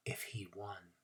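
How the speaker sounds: whisper. A narrator's reading. Narrator's hushed aside.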